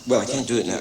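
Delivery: usual speaking voice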